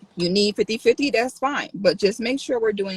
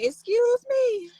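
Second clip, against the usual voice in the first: high, slow drawn-out Excuse me; sassy excuse me; slower than usual. Retold or imitated. Streamer showing how she nearly snapped at a commenter, sassy. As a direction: deliver it with offended sass. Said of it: "I'm about to say".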